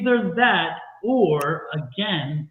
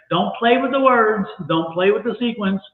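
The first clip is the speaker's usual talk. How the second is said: ordinary talk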